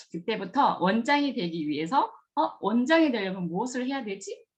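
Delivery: ordinary delivery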